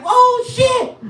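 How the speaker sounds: high, laughing mock shock; squealed comic alarm; shocked yelp. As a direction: cry out as the teeth fly off. Shock as teeth fly.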